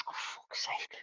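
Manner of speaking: whispered groan of annoyance at yet another invite; exasperated whisper. Retold or imitated. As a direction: hiss it under your breath, fed up. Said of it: "and you go".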